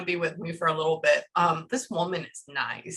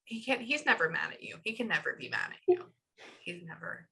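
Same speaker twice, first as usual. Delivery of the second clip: ordinary talk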